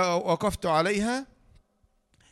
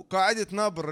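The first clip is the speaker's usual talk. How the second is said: ordinary talk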